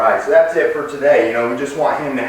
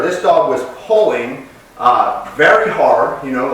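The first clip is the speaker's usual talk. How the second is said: ordinary talk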